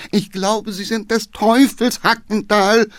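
high, loud despairing moan; groaning cry. Acted. Councillor groans from the back seat at the coachman. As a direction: groan it out, shaken to bits. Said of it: "stöhnte der Geheimrat".

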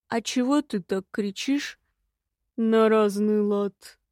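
slow, stretched-out question; drawling. A narrator's reading. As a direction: draw each word out lazily. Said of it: "протянул он".

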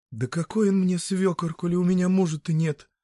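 plain reading voice

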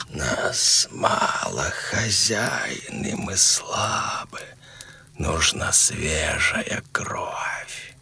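put-on slow whisper of a dying old man; very old faint voice. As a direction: rasp it out, barely voiced, slow. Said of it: "будто шёпот умирающего старика".

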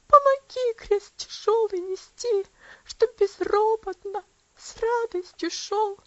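high, tearful and trembling, close to sobbing; tearful prayer. A narrator's reading. Girl praying to her Saviour through tears, high and tearful. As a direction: pray through the tears, voice catching. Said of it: "Повторила она".

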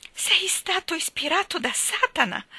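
high shocked reproach. A narrator's reading. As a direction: scold him as if he were damned. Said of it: "lo rimproverò Dora".

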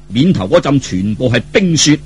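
ordinary delivery

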